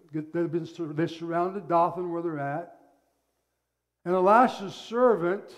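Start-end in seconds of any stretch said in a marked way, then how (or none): none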